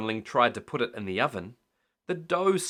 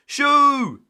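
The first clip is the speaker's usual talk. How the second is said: high, loud shout to scare cows; a loud, high brave shoo; 12 dB louder than usual. A narrator's reading. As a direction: shoo them away bravely. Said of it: "he said bravely".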